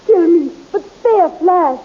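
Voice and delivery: high, loud, frantic begging; desperate pleading. Acted. Dale begging the king to spare Flash, frantic plea. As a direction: cry out for his life, slowing at the end.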